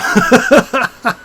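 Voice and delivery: loud, high burst of laughter. Real, not acted. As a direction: laugh out loud at your own punchline. Laughs at own punchline.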